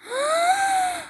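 big high gasp sucking in air; wolf filling his lungs with a gasp; deep wolf inhale. A narrator's reading. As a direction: suck in a huge breath to blow. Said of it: "the wolf inhaled deeply".

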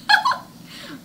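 high teasing giggle; high mocking laugh. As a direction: laugh at her. Boy laughing at Linda.

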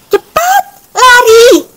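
very high-pitched shout to hurry; high alarm, rising to a loud shout; urgent cry. A narrator's reading. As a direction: hurry everyone away with a piercing call. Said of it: "seru Yaki lagi".

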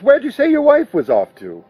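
cutting in before the wife is mentioned further; quick cut-in. A narrator's reading. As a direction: jump in fast to change the subject. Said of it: "Rudy was quick with".

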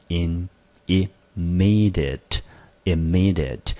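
slow, "immediate" with the weight on "me"; stress on second syllable. Shown as a demonstration. Teacher modelling "immediate" for students, slow with second-syllable stress. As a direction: draw out "immediate" slowly, leaning on "me". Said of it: "重音节落在第二个音节".

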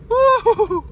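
high, loud woo; excited high cheer; relieved whoop. Real, not acted. Relief after the car wash.

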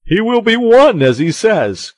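high, loud proclamation; crying out. A narrator's reading. Porthos announces that Aramis will be an abbé, crying out. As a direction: proclaim it to the whole company. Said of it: "cried Porthos".

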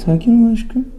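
very high, sweet-talking a pet; cooing. Real, not acted. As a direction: soothe a tense cat in a tiny high voice. Vet soothing the cat. (tiz sesle yatıştırma)

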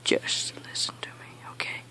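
barely voiced, strained patience; whispered plea. Real, not acted. Gamer begs teammate to listen, whispered plea. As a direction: whisper it, holding back the anger. Pleading with teammate between outbursts.

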